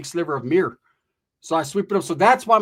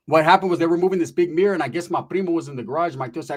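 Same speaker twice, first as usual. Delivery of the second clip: ordinary talk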